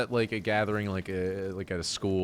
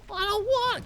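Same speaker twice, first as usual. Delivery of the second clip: put-on whiny kid. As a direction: whine like a reluctant little kid. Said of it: "Connor, go get it".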